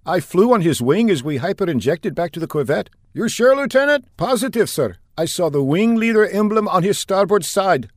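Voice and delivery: insist, respectfully but urgently; young lieutenant correcting his superiors; eager lieutenant. Acted.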